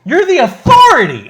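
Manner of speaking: loud, high exasperated yell; indignant shouting. Real, not acted. Host berates the ref for backing down, indignant shout. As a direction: yell it like scolding a useless referee.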